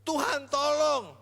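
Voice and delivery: high, loud, slow cry for help; desperate cry. Retold or imitated. Canaanite mother crying out to the Lord, high and slow. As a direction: drag out the plea.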